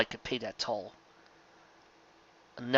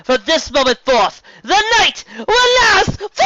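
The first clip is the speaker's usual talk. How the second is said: much higher, loud building to a shout; villain's proclamation; 12 semitones higher, 8 dB louder than usual. A narrator's reading. Nightmare Moon threatens the ponies, loud high villain shout. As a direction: proclaim doom and gloat.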